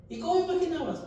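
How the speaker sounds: high, loud, teasing question; playful surprise. Retold or imitated. Therapist joking back with a laughing patient, playfully surprised. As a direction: tease her with mock amazement.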